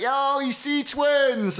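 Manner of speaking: calling out. Retold or imitated. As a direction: yell it out like a guy spotting you from his car. Driver calls out from car.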